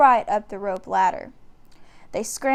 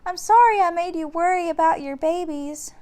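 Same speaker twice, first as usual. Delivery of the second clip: put-on much higher, louder girlish apology; young girl soothing a beast; little girl; 8 semitones higher, 5 dB louder than usual. A narrator's reading. Annie apologising to the dinosaur, high girlish voice. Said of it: "she said".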